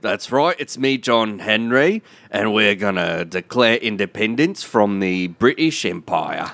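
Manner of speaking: put-on English accent drifting into Australian; attempted English accent. John Henry declares independence, Australian-sounding English accent. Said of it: "because you sound Australian".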